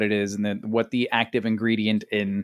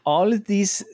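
put-on Swiss German accent; 8 semitones higher than usual. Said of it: "read it with a Swiss accent".

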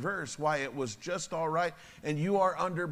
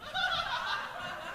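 high mocking laugh; 5 dB quieter than usual. Mocking the man's breakdown.

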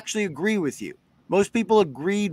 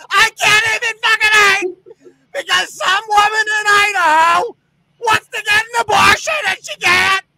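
hammed-up meltdown; 9 semitones higher than usual. Mocking a brewery owner, high fake sobbing tantrum. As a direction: ham up a sobbing tantrum over a cancelled holiday.

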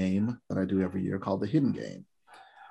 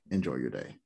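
deadpan; 9 semitones lower, 8 dB quieter than usual. Real, not acted. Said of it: "You sounded so enthusiastic explaining that".

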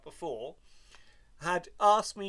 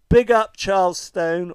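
loud, drawn-out shout-out; big up a listener at full volume; 3 semitones higher, 13 dB louder than usual. Real, not acted. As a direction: proclaim it loudly and slowly.

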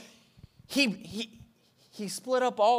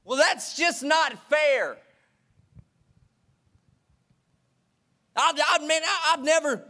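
put-on whiny older son; 8 semitones higher, 4 dB louder than usual. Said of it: "listened to the lies of the enemy and said".

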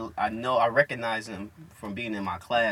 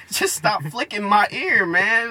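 higher, louder, drawn-out protest; acted weary objection; retold exasperated plea; 8 semitones higher, 5 dB louder, slower than usual. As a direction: plead slowly, fed up with it. Retells pleading with classmate.

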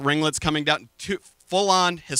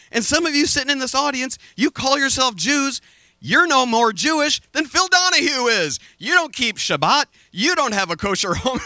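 raised scolding; 8 semitones higher, 3 dB louder than usual. Retold or imitated. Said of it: "he turns around and he goes".

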